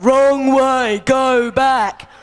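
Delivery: loud, emphatic sign-reading; blunt warning sign. Acted. Comedian contrasting blunt signs with polite English ones, loud warning. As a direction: call it out like a stern warning.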